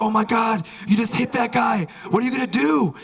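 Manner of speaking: high-pitched aghast urgency; mock alarm. Bystander to the driver who hit a man, high aghast voice. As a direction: act out a horrified bystander. Witness confronts shocked driver.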